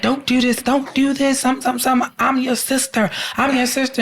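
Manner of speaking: high, mock desperate begging; a hint of hysterical begging; pleading sister. Tied-up sister begs her killer, high mock pleading. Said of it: "she's screaming, hollering".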